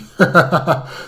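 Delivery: loud, high burst of nervous laughter. Real, not acted. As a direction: laugh off the fright. Laughs after a scare.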